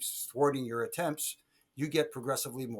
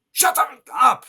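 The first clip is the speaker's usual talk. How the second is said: sudden loud snap, much higher; angry shout; 12 semitones higher, 15 dB louder than usual. Retold or imitated. Wrestler yelling at the referee to shut up. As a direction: bark it at the nagging ref. Eddie snapping at ref mid-match.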